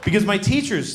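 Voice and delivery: ordinary talk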